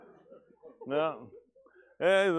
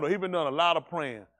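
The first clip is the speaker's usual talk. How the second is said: usual speaking voice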